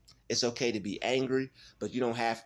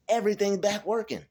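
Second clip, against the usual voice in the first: excited; 9 semitones higher, 4 dB louder than usual. Real, not acted. Repeats it, overjoyed.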